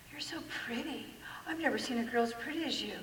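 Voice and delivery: soft, breathy whisper of praise; whispered flattery.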